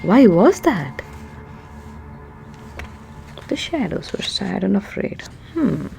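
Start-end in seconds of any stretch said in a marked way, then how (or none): none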